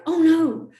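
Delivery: high, loud "oh no"; hammed-up dismayed cry; retold dismay. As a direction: wail in mock horror. Retells horror at numb kiss.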